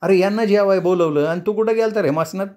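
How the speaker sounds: high, complaining mock yell; scolding mother's yell. A narrator's reading. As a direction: scold the boy as his mother. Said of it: "आम्मा ओरडली".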